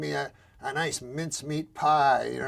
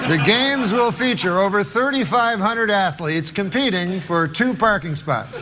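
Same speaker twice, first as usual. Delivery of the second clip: news read, laughing; 5 semitones higher, 7 dB louder than usual. Acted. Reads fake news items, laughing.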